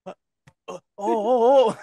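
slow, loud, high oh oh oh; drawn-out realization. Retold or imitated.

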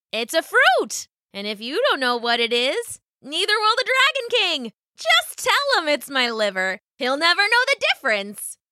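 high, bright scheming rabbit voice; clever rabbit. A narrator's reading. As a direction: share the trick eagerly. Rabbit speaks.

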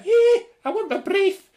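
very high, loud, stretched-out Scouse; mock Scouse accent. Apologising to Scousers, then imitating them, high mock Scouse accent. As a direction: wail it in caricature Scouse. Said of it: "why they talk so high".

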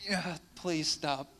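slow, long-suffering plea to stop; pained mock plea. Parent enduring a kid's bad violin, pleading slowly. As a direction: beg them to stop, half joking. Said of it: "and you're like".

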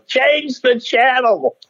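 high, drawn-out mock audience heckle; heckler's shout. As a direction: imitate a heckler calling out, slow and high. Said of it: "hear somebody in the audience go".

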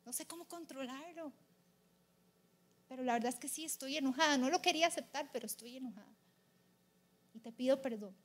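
acted sorrowful prayer, fading soft; subdued retold repentance; pleading apology. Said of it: "Y luego le pedí perdón".

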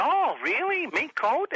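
very high, rising surprised questions; mock excited squeal; delighted interest. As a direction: imitate someone suddenly thrilled by the offer. Imitating the excited reply.

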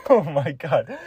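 words squeezed through giggles; fighting back laughter; cracking up mid-sentence; laughing while talking. Real, not acted. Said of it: "I have to do this without laughing".

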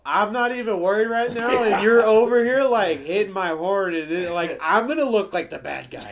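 exasperated protest. Retold or imitated. Driver to passenger honking his horn, exasperated protest. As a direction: protest loudly as the embarrassed driver.

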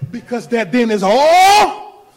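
loud, rising preacher's build-up; full-force preaching. Acted. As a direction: press the point harder on every phrase.

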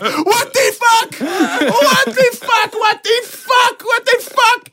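very high, slow, strung-out shriek; hammed-up panicked driver; driver going crazy. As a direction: shriek like a freaked-out cab driver. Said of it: "The cab driver's going crazy".

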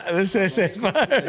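high, slow, laughing through the words. Real, not acted. Telling a friend about eating the bar, laughing. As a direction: giggle the words out.

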